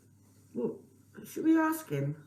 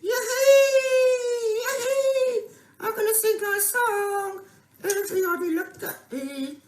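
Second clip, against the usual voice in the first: excited; 12 semitones higher, 7 dB louder than usual. Pretend. Puppet thrilled to be singing.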